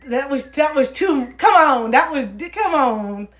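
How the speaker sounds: high, loud and slow protest; exasperated. Real, not acted. Complaining to the DJ, high, loud and drawn out. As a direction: drag it out in outrage. Outraged at the DJ's switch.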